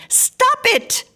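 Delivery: high, loud cry to stop the bell. A narrator's reading. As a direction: shriek it out at the buzzing doorbell. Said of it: "she cried".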